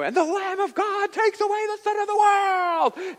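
high, full-force preaching shout; super passionate. Retold or imitated. Preacher re-enacting his conference sermon for a translator, super-passionate shout. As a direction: belt it out like a fiery evangelist. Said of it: "like, super passionate".

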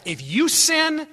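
high, slow, weighty warning; emphatic preaching. Real, not acted. Preacher warning congregation about sin's cost, emphatic. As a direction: stretch each word with force.

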